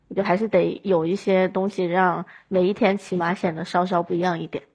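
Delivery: ordinary talk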